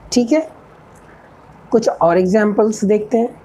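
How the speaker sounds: ordinary talk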